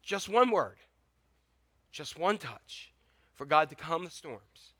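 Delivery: ordinary delivery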